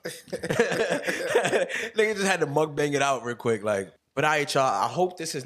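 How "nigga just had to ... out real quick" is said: laughing. Real, not acted. Laughing at own eating.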